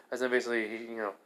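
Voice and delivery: ordinary delivery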